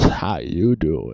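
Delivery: low, slow, soft mock-seductive greeting; deep sultry voice. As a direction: purr it into the new mic. Showing off new mic voice.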